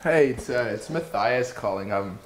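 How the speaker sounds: ordinary talk, nothing marked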